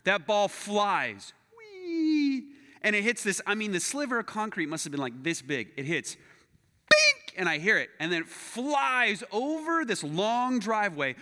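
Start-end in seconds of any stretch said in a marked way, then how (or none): 1.6-2.4 high, drawn-out whee; imitated flying whee. Storyteller mimics the golf ball flying, high whee. 6.9-7.3 loud, high bink; ping it like a ball off concrete; imitated impact bink. Storyteller mimics ball hitting concrete, loud bink.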